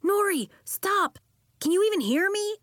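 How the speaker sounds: high, loud desperate yell; a boy yelling at his transformed friend. A narrator's reading. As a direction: yell to get through to her. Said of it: "Elliot was yelling".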